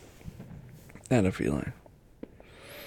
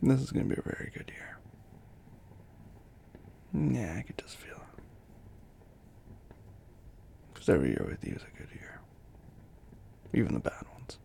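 soft breathy lover's whisper; intimate whisper; 8 dB quieter than usual. Acted. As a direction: whisper close and tender. Intimate whispered New Year talk.

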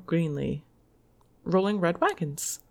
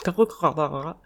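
turkey gobble; 4 semitones lower, 5 dB louder, faster than usual. Pretend. Laughing silly turkey gobble.

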